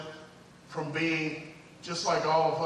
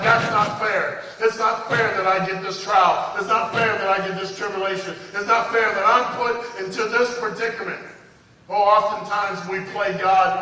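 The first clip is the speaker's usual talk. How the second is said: loud, raised preacher's delivery; full-force preaching; 3 semitones higher, 7 dB louder than usual. Real, not acted. Preacher warning the congregation about bitterness, full-force preaching. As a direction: drive the warning home with rising force.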